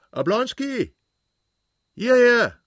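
high, loud shout across a distance; calling out. A narrator's reading. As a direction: call someone over with a ringing high shout.

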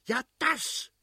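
very high-pitched furious outburst; acted irate cry; angry shout. A narrator's reading. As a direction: explode in exasperation, voice shooting up. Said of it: "schrie der Fürst zornig".

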